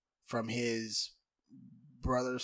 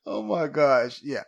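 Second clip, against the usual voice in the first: laughing; 9 semitones higher, 13 dB louder than usual. Real, not acted. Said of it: "laughing pleas to stop".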